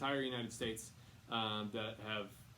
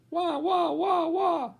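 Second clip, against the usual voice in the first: much higher, loud mocking wah-wah; mocking whine; 16 dB louder, much slower than usual. Relative mocking Helen's cries for water, loud high mocking whine. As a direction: mimic the child's whining cry to ridicule her.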